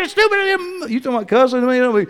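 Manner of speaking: high mock shout of a fighting spouse; retold quarrel yell. Husband yells at his wife behind the door, mock shout. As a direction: fling it like a spouse mid-fight. Couple quarrelling inside house.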